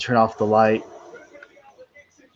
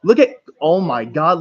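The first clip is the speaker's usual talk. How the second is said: excited shouting; 11 semitones higher, 8 dB louder than usual. Real, not acted. Excited race call at TV.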